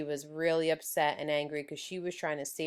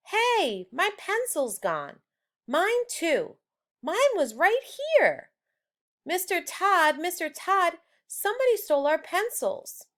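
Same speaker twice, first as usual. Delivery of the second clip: put-on much higher, whiny children's voices; upset kids; 11 semitones higher, 3 dB louder than usual. Classmates complaining to the teacher, high-pitched and upset. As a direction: squeal like outraged schoolkids. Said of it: "The whole class was in an uproar".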